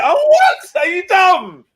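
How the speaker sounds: high, loud, disbelieving yell at a stubborn son; exasperated shout. Retold or imitated. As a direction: blow up at the kid. Scolding his stubborn son.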